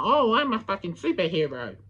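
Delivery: mock about-to-sneeze superhero voice; Nickman. Mocking a rival who claims heroism, mock sneezy voice. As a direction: imitate a stuffed-up would-be hero. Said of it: "sounds like you're about to sneeze".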